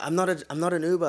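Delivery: fast, high-pitched mock protest; flustered explaining. Driver explains himself to drunk passenger, imitated flustered protest. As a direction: stumble over a hurried denial. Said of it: "I'm just trying to explain".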